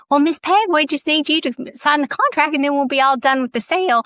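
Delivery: put-on chirpy Southern accent, rattling off the contract line; Southern agent. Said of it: "our very Southern real estate agent".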